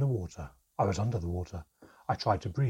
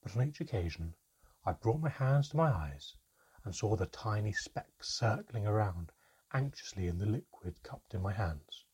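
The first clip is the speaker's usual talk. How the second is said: plain reading voice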